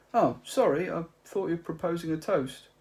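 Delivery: Ford's apology. Acted. Ford apologizing for the toast, high and breezy. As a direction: shrug off the mix-up lightly.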